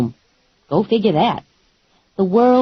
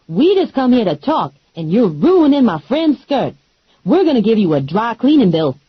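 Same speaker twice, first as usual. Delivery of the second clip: a lightly voiced, higher, sassy friend; sassy Lula; 4 semitones higher than usual. Lula warning the wrestlers, lightly voiced and sassy. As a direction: tell them off with attitude. Lula's voiced lines in fight.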